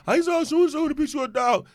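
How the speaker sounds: high-pitched mimicry of a busybody; imitating an excited gossip; mocking gossip. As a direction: put on a high, nosy tattletale voice.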